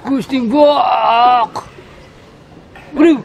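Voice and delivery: slow, loud laughing cry; laughing shout. Real, not acted.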